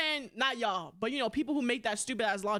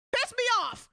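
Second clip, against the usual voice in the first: much higher and louder, exasperated; mock outburst; 10 semitones higher, 5 dB louder than usual. Shown as a demonstration. Ranting about people's logic, now a loud exasperated outburst. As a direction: blow up at it the second time.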